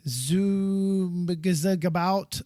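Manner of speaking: "Zoomgazagout" drawn out slowly, sounds mangled; butchered pronunciation. Real, not acted. Host attempting an unfamiliar site name, butchered "Zoomgazagout". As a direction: stumble slowly through "Zoomgazagout", guessing at it. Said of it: "I'm gonna butcher".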